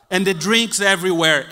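usual speaking voice

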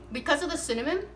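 talking through a mouthful of apple; chewing while speaking, mouth full. Real, not acted. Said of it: "I'm eating with my mouth full".

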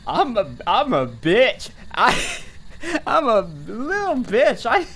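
nervous giggle after a jump scare; laughing embarrassed. Real, not acted. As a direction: laugh it off, a bit shaken. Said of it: "I jumped at the thunder".

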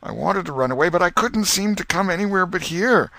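rising, high anguished moan. Acted. Potter answers the crowd, moaning helplessly. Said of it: "fell to sobbing again".

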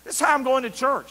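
high, loud mock cursing; boy cursing his mother on the way to church; angry cussing. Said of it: "I cussed my mother".

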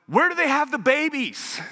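a panicked dad pleading for directions; frantic ask. Retold or imitated.

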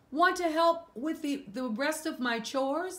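put-on high girl's voice, question rising; farm girl voice. Farm girl asking Doug to help, voiced high. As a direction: ask sweetly like a little girl. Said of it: "that she asked".

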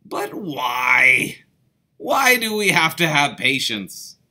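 put-on loud, whiny why from the boys; children loudly demanding to know why; insistent kids. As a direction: press daddy loudly for an answer. Children pestering their father.

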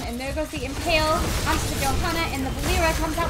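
loud, fast hyped casting; excited play-by-play. Acted. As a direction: shout the teamfight call.

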